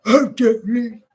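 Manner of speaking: loud put-on male greeting; mimicking husband. Settling on how husband went, loud imitation. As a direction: punch out his hello in a mock voice. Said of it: "so I think he went".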